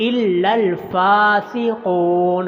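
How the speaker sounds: very slow, chanted recitation; careful tajweed chant; slow Quran recitation. Shown as a demonstration. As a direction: draw out the doubled letters in the chant. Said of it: "فا کے اوپر کھڑی زبر ہے".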